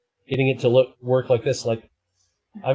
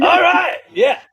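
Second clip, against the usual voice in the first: very high, loud cheer; excited cheer; 5 dB louder, slower than usual. Pretend. Streamer cheering in a filter voice, high excited shout. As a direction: whoop it out.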